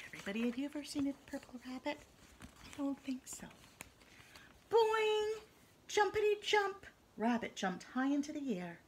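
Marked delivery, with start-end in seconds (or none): 2.4-3.5 soft unvoiced aside; whispering. Real, not acted. 4.7-6.9 high bright jump noise; high loud boing. Acted. Jump sound effect.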